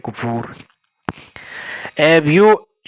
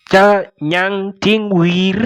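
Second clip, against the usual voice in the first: "Cha nyang ting wir" said slowly, syllable by syllable, a bit higher; slow model reading; 5 semitones higher, slower than usual. Shown as a demonstration. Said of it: "teacher models the phrase slowly".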